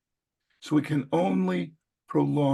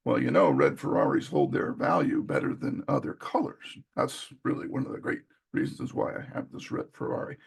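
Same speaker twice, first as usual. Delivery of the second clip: calm justification; 8 semitones lower than usual. Retold or imitated. Buyer justifying the Ferrari with logic, low and subdued. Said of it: "I'm a lot less emotional".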